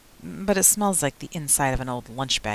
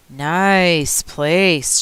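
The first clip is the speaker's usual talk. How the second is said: louder, drawn-out echo of the phrase; sister repeating; 2 semitones higher, 6 dB louder than usual. A narrator's reading. Sister echoing her brother's words about the school, repeating loudly. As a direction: repeat it loud and stretched. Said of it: "she repeats".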